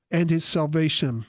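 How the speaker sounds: usual speaking voice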